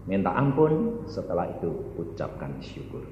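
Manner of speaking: soft, low wind-down; hushed instruction. Real, not acted. Preacher guides sinner to thanks, hushed. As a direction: drop from the shout to a gentle low voice. Drops voice after the command.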